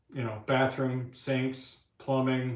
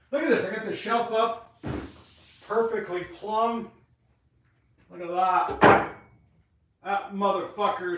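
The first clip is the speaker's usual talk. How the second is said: proud excitement; 5 semitones higher, 4 dB louder than usual. Real, not acted. Proudly shows the shelf.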